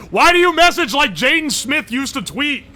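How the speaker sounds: high, loud exasperated complaint. Real, not acted. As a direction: complain like it's driving you crazy. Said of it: "It drives me insane".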